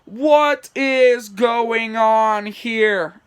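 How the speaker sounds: slow, loud, high demand; very drawn-out, high bewildered cry. Acted. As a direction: stretch out each word loudly. Two characters demand an explanation.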